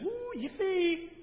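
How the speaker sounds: sarcastic cheering at hardship; mock cheer. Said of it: "No, that's not what he said".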